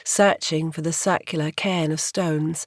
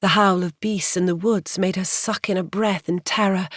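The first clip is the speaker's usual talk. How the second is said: ordinary delivery, nothing marked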